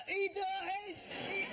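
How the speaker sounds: put-on high, slurred drunk heckle; drunk passerby shouting. Drunk passerby bellowing a spoiler at the queue. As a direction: call out like a drunk spoiling the ending. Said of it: "a minute later".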